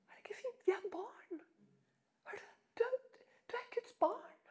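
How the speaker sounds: whispered, full of wonder; awed whisper. Real, not acted. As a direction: hush it like a secret joy. Whispered wonder at Jesus' call. (hvisket undring)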